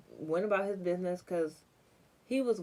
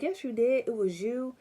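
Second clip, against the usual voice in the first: raised, insistent "yes you did"; a loud, accusing retold voice; loud accusation; 5 semitones higher, 6 dB louder than usual. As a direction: call her out loudly. Cousin's friend accusing her.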